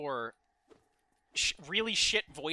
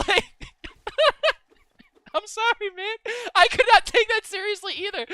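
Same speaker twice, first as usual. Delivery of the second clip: laughing; 9 dB louder than usual. Real, not acted. Cracking up at own story.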